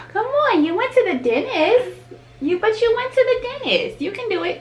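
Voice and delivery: high sing-song coaxing; coaxing mom voice. Real, not acted. Coaxing toddler to brush teeth.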